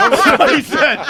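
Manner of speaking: high, loud, helpless laughter; real laughing. Real, not acted. Storyteller vouching for an outrageous quote, real loud laughing. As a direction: crack up while insisting on it. Cracking up at own retold quote.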